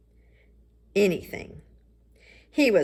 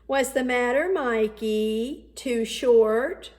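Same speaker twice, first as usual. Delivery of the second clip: mock taunt; 6 semitones higher, 5 dB louder, slower than usual. Mark taunts Michael on court.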